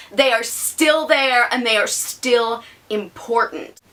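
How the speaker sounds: ordinary delivery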